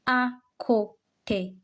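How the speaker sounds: slow "À côté" with a gap after each syllable; teacher's measured, separated "À côté". Shown as a demonstration. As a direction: spell out "À côté" by sound, final é clear. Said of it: "That is why we say".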